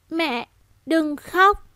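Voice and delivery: put-on high, very slow, halting little girl; a mute child's first rusty words; dry child voice. Little girl comforting her crying mother, dry halting voice. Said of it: "thanh âm khô khốc".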